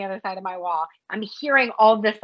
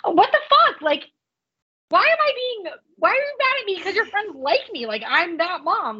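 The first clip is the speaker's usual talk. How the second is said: higher, incredulous mom; a mom's pitched-up, baffled retort to her teen; exasperated disbelief; 7 semitones higher than usual. Retold or imitated. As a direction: throw it back in mock outrage. Mom exasperated at daughter's complaint.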